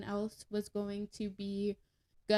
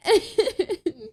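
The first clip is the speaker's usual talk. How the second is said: words broken by laughter; laughing; 3 semitones higher, 9 dB louder, much slower than usual. Real, not acted. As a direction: laugh through the line. Laughing while telling drinking plan.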